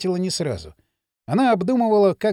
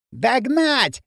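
high-pitched, stretched-out command; mild squeal of an angry order; shrieked order; 5 semitones higher than usual. A narrator's reading. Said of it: "раздался визг Пакли". (визгливый приказ)

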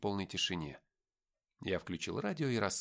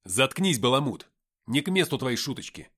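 louder snapped rebuke; barked rebuke; 3 semitones higher, 9 dB louder than usual. Acted. Driver snapping at Nikita after a slap, loud. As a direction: bark it at the joker. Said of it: "и рявкнул".

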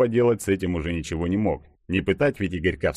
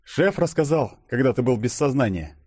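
slightly higher character voice; colleague explaining; 6 semitones higher than usual. A narrator's reading. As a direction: explain it in a raised voice. (поясняющий коллега, голос повыше)

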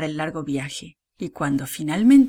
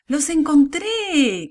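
high, long-drawn storybook cry; grito alegre de llamada; 8 semitones higher, 4 dB louder, much slower than usual. A narrator's reading. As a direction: call out to far-off brothers, stretching the last word. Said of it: "gritó José". (grito agudo y alargado)

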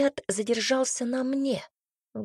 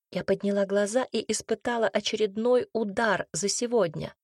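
plain reading voice

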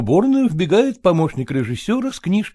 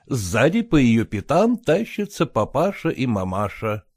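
ordinary delivery

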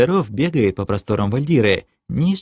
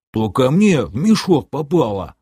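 put-on voice, higher grumble; mildly offended, pitched up; grumbling sidekick; 4 semitones higher than usual. A narrator's reading. Sidekick backing away from the sword, higher offended grumble.

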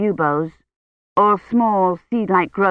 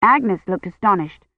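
ordinary delivery, nothing marked

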